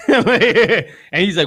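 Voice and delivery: slow words through real laughter; cracking up retelling the story; laughing. Real, not acted. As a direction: laugh it out as you set up the quote. Laughing at dumb friend.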